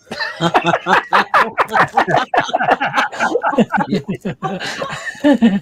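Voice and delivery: long, high peal of real laughter; uproarious laughter. Real, not acted. Listeners hear the electric fence punchline, uproarious high laughter. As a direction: fall about laughing, pitched high.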